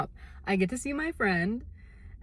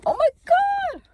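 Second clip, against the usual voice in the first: much higher, louder squeal of delight; delighted shriek; 9 dB louder than usual. Real, not acted. Seeing the lip gloss shimmer, squealing.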